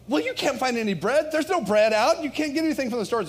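high, loud incredulous protest; mock incredulity. Pastor replying to Jack about the bread, mock incredulous. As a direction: protest in hammed-up disbelief, rattling off the end.

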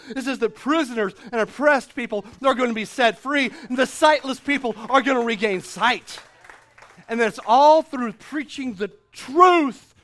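full-force preaching. Acted. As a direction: climb to a passionate peak. Preaching the results of grace.

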